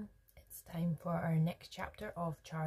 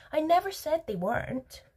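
high, rising protest; protesting denial; 11 semitones higher, 4 dB louder than usual. A narrator's reading. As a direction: deny it indignantly with a rising end. Wonka denies it in a quarrel.

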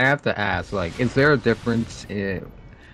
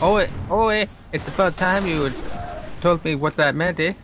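high, hammed-up Canadian accent; mock Canadian, pitched well up; put-on Canadian accent; 7 semitones higher than usual. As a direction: ham up a Canadian with every eh. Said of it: "drop my Canadian accent".

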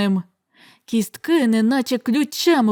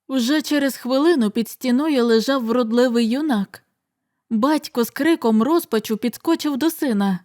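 ordinary delivery

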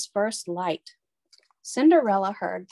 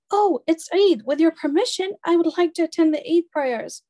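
put-on higher, louder sweet young girl; polite girl voice; 7 semitones higher, 4 dB louder than usual. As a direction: gently ask permission, voice lifted high. Cinderella pleading to stepmother.

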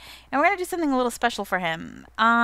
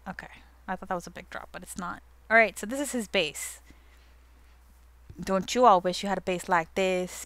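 ordinary talk, nothing marked

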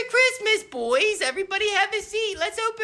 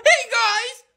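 usual speaking voice

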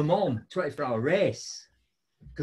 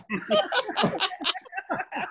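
a high burst of laughter; 13 semitones higher than usual. Real, not acted. Laughing at his own story to the host, high laugh.

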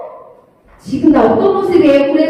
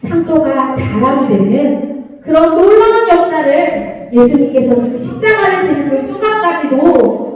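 usual speaking voice

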